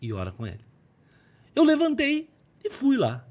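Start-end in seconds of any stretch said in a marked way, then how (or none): 0.0-0.6 low, soft voice of God; voz de Deus. Retold or imitated. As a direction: say it low and quiet, as a calm divine command. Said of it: "E Deus falou assim". (voz de Deus, grave e baixa)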